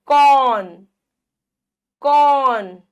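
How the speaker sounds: कौन with a loud, wide औ; कौन sounded out loudly, vowel held; open-mouthed vowel. Shown as a demonstration. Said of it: "बड़ा मुंह खुल जाएगा".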